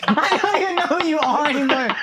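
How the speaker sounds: high, laughing echo of the line; laughing quote. Retold or imitated. Fan quoting the clip's best line, high and laughing. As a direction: repeat the favourite line while laughing. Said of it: "quotes the famous line".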